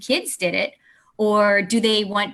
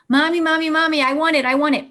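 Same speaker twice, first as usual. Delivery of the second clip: put-on higher, louder pleading child voice; whiny toddler begging; 7 semitones higher, 3 dB louder, much faster than usual. Toddler begging mom at the vending machine, higher and whiny. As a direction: beg like a little kid who wants the toy.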